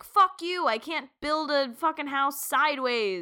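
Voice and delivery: stubborn builder telling off a client; angry rant. Retold or imitated. Architect parody rant.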